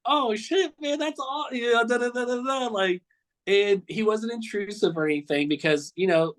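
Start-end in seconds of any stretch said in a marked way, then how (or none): 0.0-2.7 much higher, starstruck exclamation; excited outburst. Retold or imitated. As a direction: squeal it like you can't believe it. Own starstruck reaction retold.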